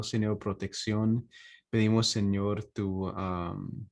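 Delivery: normal talking voice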